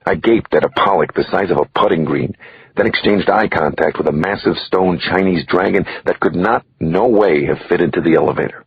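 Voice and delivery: ordinary delivery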